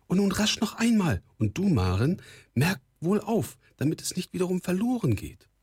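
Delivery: high-pitched, excited instruction; eager urging. A narrator's reading. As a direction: urge them on and tell her to pay attention. Excited mother urges them on. (aufgeregt drängend, mit hoher Stimme)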